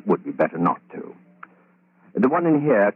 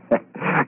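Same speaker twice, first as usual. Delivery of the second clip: evil chuckle; 4 semitones higher than usual. Acted. Killer chuckles at trapped Betty. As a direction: chuckle as the air runs out.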